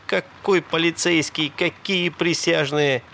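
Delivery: ordinary delivery, nothing marked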